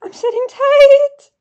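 high, loud, stretched-out wail; exasperated squeal. Real, not acted. Fan stuck waiting on the website, exasperated squeal. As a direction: squeal it slowly, climbing on the last word. Answering the site's sit tight.

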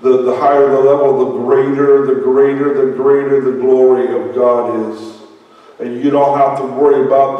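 loud, driving preacher cadence; fired-up sermon delivery; preaching. Acted. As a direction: preach it out with full force. Preaching at full force.

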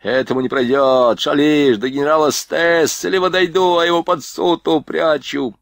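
high, tearful threatening sergeant; a sergeant whining his threats; whiny threat. A narrator's reading. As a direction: threaten while close to tears. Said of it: "плаксивым голосом грозился".

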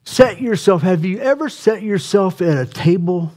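climbing preacher's cry; preaching at full force. Acted. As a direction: build the plea up like a revival preacher.

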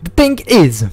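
reading the note in a lightly put-on English accent. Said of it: "il est bon mon accent". (lecture avec un léger accent anglais)

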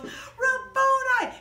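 high, loud, very drawn-out call; overjoyed cry. Acted. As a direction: belt it out joyfully. Mary calls to her teacher.

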